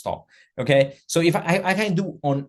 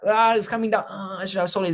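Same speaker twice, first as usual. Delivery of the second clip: high, wailing mock dismay; acted regret; 5 semitones higher than usual. Trader watching price come down, mock regretful. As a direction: lament like a trader who missed out. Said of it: "I'm regretting I didn't sell it".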